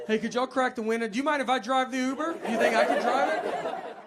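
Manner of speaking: half laughing at his own desperation; mock panic, pleading to be let out; claustrophobic panic. Panicking in the Uber again.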